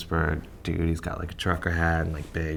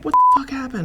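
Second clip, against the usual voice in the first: high, loud exclamation; mock shouted alarm; 7 dB louder than usual. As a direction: yell it as a shocked booker. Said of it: "he's like".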